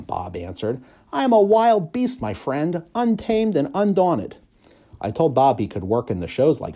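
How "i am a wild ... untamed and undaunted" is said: a tiny dog proclaiming himself untamed; puffed-up little dog declaring his freedom; proud wild beast. Acted.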